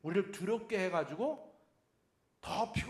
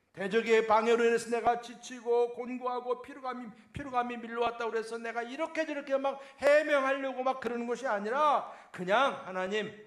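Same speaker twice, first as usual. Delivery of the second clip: higher, louder fervent sermon; preaching; 8 semitones higher, 8 dB louder than usual. Acted. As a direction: preach with rising fervor. Preaching at full force.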